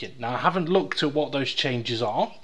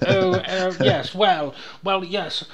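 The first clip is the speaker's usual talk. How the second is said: higher, louder hammed-up posh hesitation; a pompous toff dithering over his words, pitched up; mock posh stammer; 3 semitones higher, 5 dB louder than usual. Mocking how posh people talk, hammed-up higher posh voice. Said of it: "the posh do kind of go".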